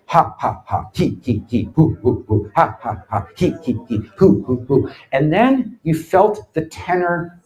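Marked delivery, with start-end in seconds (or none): 0.1-4.9 mock laughing-yoga chant of ha he hoo; low, rhythmic put-on laughing; forced fake laughter. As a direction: imitate a crowd forcing a laugh. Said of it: "everyone would be sitting there and go".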